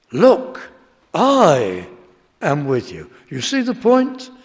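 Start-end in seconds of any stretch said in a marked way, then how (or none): 0.1-3.1 loud "Look", then a long, loud "I" set apart before "am with you"; emphasis on I. Shown as a demonstration. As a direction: punch "I" alone, then drop away on "am with you". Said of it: "all the emphasis is on that word".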